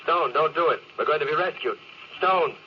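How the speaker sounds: high, urgent pleading; desperate plea. Acted. Captain begging Stone not to smash his faceplate. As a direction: beg him to stop.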